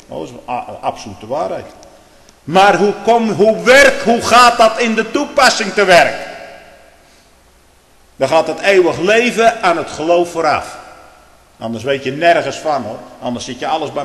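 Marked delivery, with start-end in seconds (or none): 2.4-10.8 loud, high pulpit voice; fiery preaching. Acted. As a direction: thunder it from the pulpit. Preaching at full force. (vurig preken)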